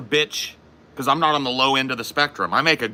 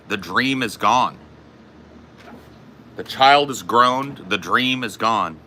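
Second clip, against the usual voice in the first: slow solemn refrain; slower than usual. Real, not acted. Intones a slow mock-solemn refrain.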